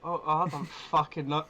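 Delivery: normal talking voice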